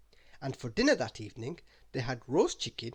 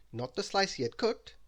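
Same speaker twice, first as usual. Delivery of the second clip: usual speaking voice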